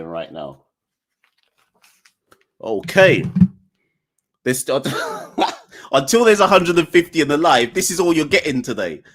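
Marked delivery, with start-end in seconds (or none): none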